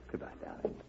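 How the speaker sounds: a soft whispered farewell; whispered goodbye. Acted. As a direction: breathe the goodbye close to her ear. Duke leaving Lola.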